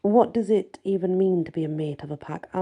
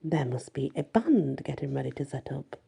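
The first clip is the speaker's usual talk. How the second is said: ordinary delivery, nothing marked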